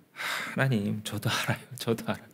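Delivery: soft, hushed confession to God; penitent prayer. Acted. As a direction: quietly admit it, head bowed. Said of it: "이렇게 했어야 돼요". (조용히 고백하는 기도)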